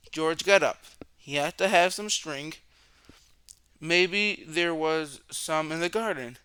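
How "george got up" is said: ordinary delivery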